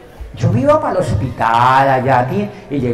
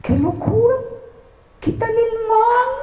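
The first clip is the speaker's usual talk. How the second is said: mock excited cry. Voiced exclamation from bystanders.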